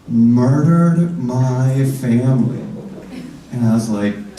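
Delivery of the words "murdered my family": slow grave accusation. Acted. Fruit seller's grave accusation.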